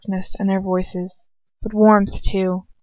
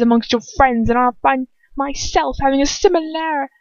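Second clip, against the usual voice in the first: cold counter-threat; 6 semitones higher than usual. Acted. Lucius threatens Bellatrix back, rising into a whisper. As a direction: turn the threat back on her.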